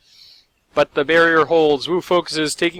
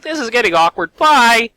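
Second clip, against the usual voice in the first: awkward bye; 8 semitones higher, 6 dB louder than usual. Retold or imitated. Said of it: "It's just like".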